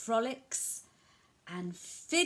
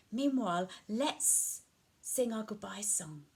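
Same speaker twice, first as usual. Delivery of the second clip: ordinary delivery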